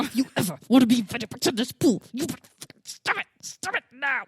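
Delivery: half-laughing scolding mom voice; mock angry mom. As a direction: rattle off the threat to the kid. Mom scolding kids at pool.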